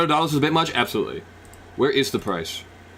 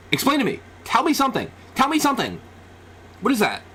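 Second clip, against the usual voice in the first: high-pitched, insistent pleading; exasperated demand; 10 semitones higher, faster than usual. Real, not acted. Demands explanation from the screen.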